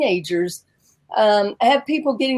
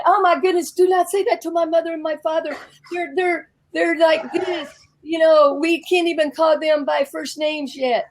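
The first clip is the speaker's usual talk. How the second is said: high-pitched scandalized friends; mock alarm; 5 semitones higher than usual. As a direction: imitate friends urgently warning her off, pitched up. Acting out alarmed German friends.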